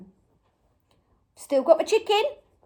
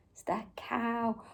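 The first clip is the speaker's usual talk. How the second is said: whispery, much softer and lower; hushed; 5 semitones lower, 14 dB quieter than usual. Pretend. Presenter quietly repeating the answer to children, hushed whisper. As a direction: say it under your breath.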